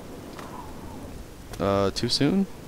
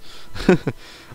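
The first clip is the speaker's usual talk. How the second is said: short unvoiced laugh; 8 semitones higher than usual. Real, not acted. Reacting to the book's strange words.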